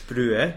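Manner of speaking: loud, two-beat Brewe, rising; pronunciation demo. Shown as a demonstration. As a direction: model Brewe as "broo-eh" for a learner. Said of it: "try it the second way".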